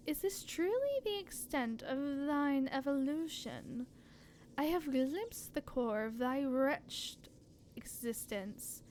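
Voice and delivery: slow, soft ancient goddess; solemn deity voice. Acted.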